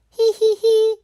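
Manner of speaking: loud, high burst of giggling; high giggles. Acted. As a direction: laugh together happily. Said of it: "everyone laughs".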